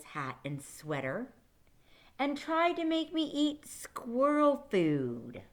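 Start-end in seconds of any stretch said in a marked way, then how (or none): 2.2-5.4 slow, louder, higher disgust; disgusted complaint. Acted. Beast complaining to class, slow and disgusted. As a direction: drag it out like it's gross.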